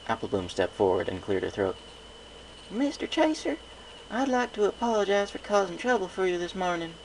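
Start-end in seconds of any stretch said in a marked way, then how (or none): 2.7-6.9 high filly voice, formal and earnest; a little pony reciting a polite apology; young filly apologizing. A narrator's reading. Apple Bloom formal apology.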